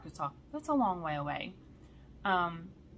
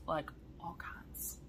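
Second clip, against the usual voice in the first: whisper; 3 semitones lower, 9 dB quieter, slower than usual. Shown as a demonstration. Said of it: "all kinds of kitted projects".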